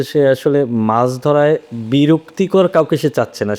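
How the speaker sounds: ordinary talk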